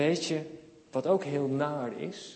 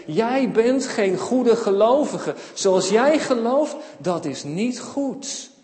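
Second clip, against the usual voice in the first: slow, raised, emphatic rebuke; stern disapproval; 4 semitones higher, 6 dB louder, slower than usual. Acted. Believers rejecting a Samaritan, slow stern rebuke. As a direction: pronounce judgement slowly and forcefully.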